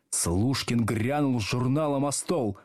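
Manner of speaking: ordinary delivery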